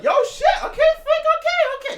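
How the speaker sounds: high squeaky laughing panic; startled fan squeaking back at a stern coach; startled high yelp. Retold or imitated. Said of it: "I'm gonna be like".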